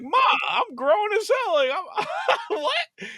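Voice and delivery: high, laughing mock protest to mom. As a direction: squeak out the protest through laughter. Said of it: "I'm like".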